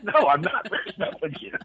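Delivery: high, laughing protest; laughing retelling. Real, not acted. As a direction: quote the reassurance through real laughter. Laughs retelling reply to girlfriend.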